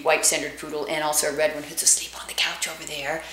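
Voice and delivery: whispered aside. Real, not acted. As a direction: whisper so the sleeping dog stays asleep. Aside about sleeping dog.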